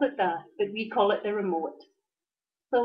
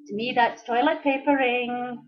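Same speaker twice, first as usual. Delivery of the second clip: ordinary talk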